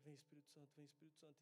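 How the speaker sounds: soft, earnest calling on the Spirit; quiet prayer. Retold or imitated. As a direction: murmur the prayer under your breath. Praying over the screaming boy.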